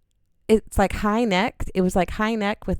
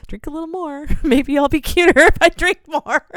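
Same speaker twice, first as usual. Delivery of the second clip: high, giggly voice rising louder; tipsy self-coaxing; 9 semitones higher, 8 dB louder than usual. Retold or imitated. Insecure woman drinking beside a cute guy, tipsy giggle. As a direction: play your drunk, insecure self, laughing through it.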